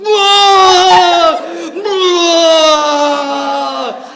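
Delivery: loud, high retching groans; mock retching. Drunk friend being sick in the toilet, loud mock retching groans. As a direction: heave it out over the bowl. John vomiting over the toilet.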